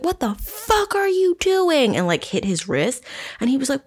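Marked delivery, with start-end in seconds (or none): 0.0-1.9 high-pitched, drawn-out retold outrage; incredulous mock yell, slow and high; re-enacted outrage. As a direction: act out losing it, pitch shooting way up. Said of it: "I, like, lost my shit".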